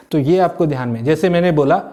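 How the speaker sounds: ordinary delivery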